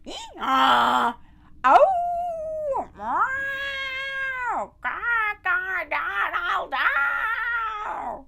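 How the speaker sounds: high, loud whooping animal noises; raucous animal chorus; animals' wild song. Acted. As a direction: howl and meow like the band. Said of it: "they'd sing their song".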